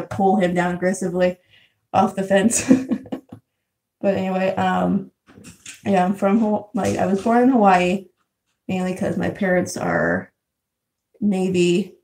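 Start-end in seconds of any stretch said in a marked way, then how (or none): none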